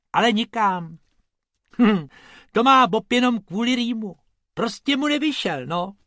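high, loud little rabbit; cheeky rabbit voice. Acted. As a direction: bluff your way through the excuse. Rabbit explaining himself.

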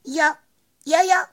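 very high little chirped agreement; squeaking cricket. A narrator's reading. Cricket agreeing with the bumblebee, high loud squeak. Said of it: "kníkl Cvrček".

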